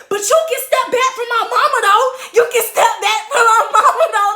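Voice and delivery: high, loud confrontational shout; heated, full-voiced warning; angry yell. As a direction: yell him back off your mama. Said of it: "Like, I'm yelling at him".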